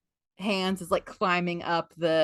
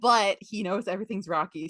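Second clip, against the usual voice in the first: normal talking voice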